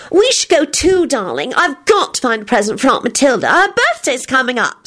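high, bright feminine chatter; chirpy high-voiced suggestion; bright outburst. A narrator's reading. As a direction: burst out brightly, pitched high. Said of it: "Solange burst out brightly".

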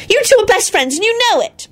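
high pleading cry; frantic high-pitched appeal; desperate wail. Acted. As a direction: wail it at both of them. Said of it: "Solange wailed desperately".

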